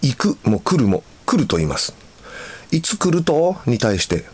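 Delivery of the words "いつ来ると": higher-pitched Hakata dialect question; lightly acted Hakata questioner. Shown as a demonstration. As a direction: ask it with a Hakata lilt. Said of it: "福岡の方は".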